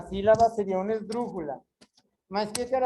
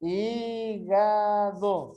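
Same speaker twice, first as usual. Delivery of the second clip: "Hígado" very slow, as three long syllables; much slower than usual. Shown as a demonstration. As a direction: stretch out each syllable of "Hígado". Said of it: "separa em sílabas". ("Hígado" sílaba por sílaba, bem devagar)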